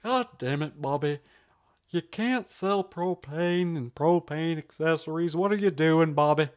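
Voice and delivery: exasperated cartoon dad impression; Hank Hill. Scolding Bobby about propane, Hank Hill impression. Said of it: "perfect Hank Hill".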